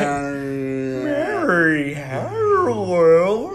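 very slow, warped toy song; imitating a toy with dying batteries. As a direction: drag it like a toy on low batteries. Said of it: "when the batteries are low".

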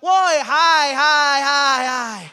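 high holler, fading at the end; shouted answer. Acted. Echo answering from the mountain, loud high shout falling away. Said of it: "di sana jawab".

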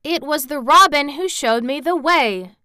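put-on stubborn child insisting; obstinate protest. A narrator's reading. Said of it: "she protested obstinately".

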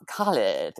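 "Khalid" said slowly and carefully; careful name pronunciation. Retold or imitated. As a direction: draw out "Khalid" to show the name. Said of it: "isn't even my real name".

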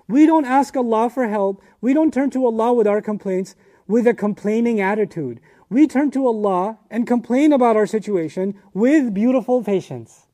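impassioned preacher's call; fervent preaching. Acted. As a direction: proclaim it to the whole congregation. Preaching at full force.

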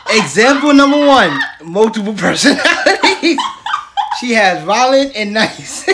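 loud, laughing tease. Real, not acted. Host laughing while teasing her cohost.

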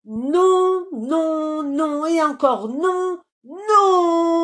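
des non criés, très forts et aigus; martèle chaque non comme un rugissement; refus rugi. A narrator's reading. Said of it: "rugit le lion". (le lion refuse en hurlant, très fort)

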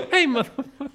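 high, loud laughing yell; laughing shout. Pretend. Greeting the friend in a high laughing yell. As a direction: blurt it through a laugh.

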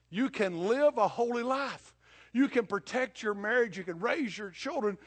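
loud, high, fast pulpit build; preaching at full force. Acted. Preacher closing sermon to congregation, loud building preaching. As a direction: climb toward a fervent climax.

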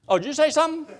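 high-pitched mock-innocent question; lightly acted surprise at being called; feigned oblivious reply. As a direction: answer as if you only just noticed. Acting husband who ignored the call.

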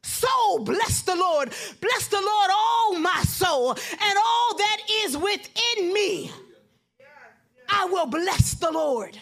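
loud, rising sermon climax, drawn out; shouting praise to the congregation; preaching at full force. Real, not acted. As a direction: preach it full voice over the organ. Preaching climax, music behind.

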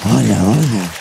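low, whispery tempting devil. Acted. Satan tempting the comedian to look, low and sinister. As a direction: lure him in a low sinister voice. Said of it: "Ele falou".

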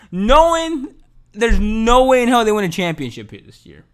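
ordinary talk, nothing marked

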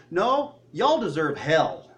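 slow, loud, drawn-out mock rebuke; acted blunt rebuke. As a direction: lay it down slowly and loudly, as a boy telling off other kids. Boy rebuking the kids, acted quote.

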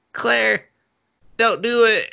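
voicing Suichi begging Claire not to shoot; pleading call. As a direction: cry out to stop her, hammed up.

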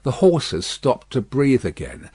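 usual speaking voice

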